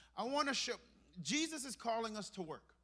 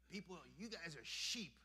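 mocking insult; 5 semitones lower, 10 dB quieter than usual. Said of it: "trying to offend you by calling you a sheeple".

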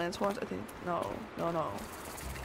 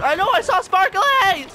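indignant exclaim; 9 semitones higher, 7 dB louder than usual. Real, not acted. Tricked by fake grass.

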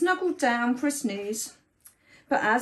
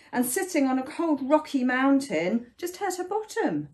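plain reading voice

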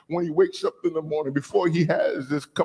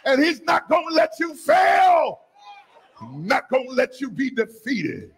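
shouted preaching; 8 semitones higher than usual. Real, not acted. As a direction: shout the promise to the church.

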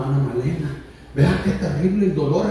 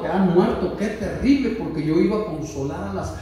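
usual speaking voice

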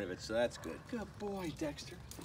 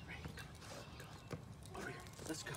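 soft breathy whisper to the dog; whispered coaxing; 16 dB quieter than usual. Real, not acted. Owner quietly calling puppy over, whispered coaxing. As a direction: coax him over in a hushed whisper.

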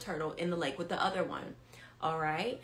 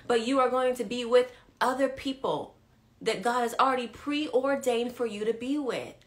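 louder, rising preacher cadence; preaching at full force; 5 semitones higher, 6 dB louder than usual. Acted. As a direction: preach it to the online flock. Preaching builds loud.

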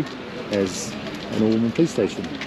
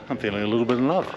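usual speaking voice

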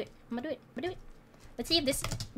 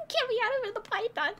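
normal talking voice

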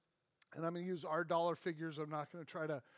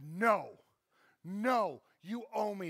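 angry refusal; 3 semitones higher, 6 dB louder, slower than usual. Acted. Servant refusing debtor.

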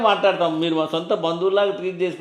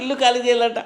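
mocking laugh; 6 semitones higher than usual. Tenant mocks eviction demand.

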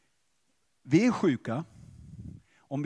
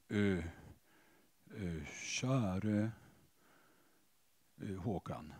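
very low, soft, halting address; put-on stiff prayer; 12 semitones lower, 14 dB quieter, slower than usual. Man approaching Håkan as God, altered into a halting prayer voice. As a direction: hesitate timidly between each word, hushed. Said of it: "så gör jag om mig själv".

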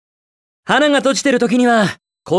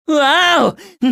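slow, stretched-out wow, pitched up; drawn-out wow; 6 semitones higher, much slower than usual. Acted. Hero spotting something big, stretched wow. Sees something startling in game.

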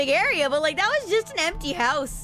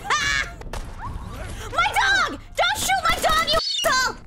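angry yell; 11 semitones higher than usual. Real, not acted. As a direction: yell to protect the dog. Dog shot, yelling.

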